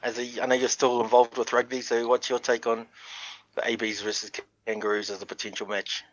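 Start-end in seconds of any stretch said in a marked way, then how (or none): none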